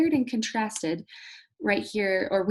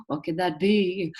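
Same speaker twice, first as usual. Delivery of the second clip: mock surprise. Mock gasp at the light.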